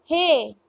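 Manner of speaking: he said as breathy-h "heh"; Spanish letter G. Shown as a demonstration. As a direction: say he with a pure Spanish e, not "hee". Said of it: "G is pronounced as".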